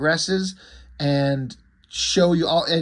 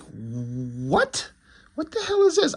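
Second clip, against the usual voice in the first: mock shock; slower than usual. Comic reader reacting to the demon's appearance, shrill jump in pitch. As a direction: act out the reader's sudden jolt of shock, voice leaping up. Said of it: "So you're like".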